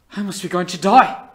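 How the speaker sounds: high, full-volume terrified cry; claustrophobic panic. Acted. Trapped soul thinking death is coming, screaming panic. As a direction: peak of panic, voice shooting up to a shout. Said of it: "gripped by a claustrophobic panic".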